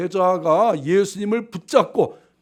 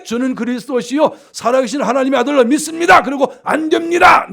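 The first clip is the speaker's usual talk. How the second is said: loud, raised mock declaration; incredulous mimicry; 4 semitones higher, 7 dB louder than usual. Preacher replays the disciple's contradiction, loud and incredulous. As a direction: throw it out forcefully, building to the refusal.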